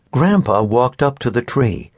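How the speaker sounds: plain reading voice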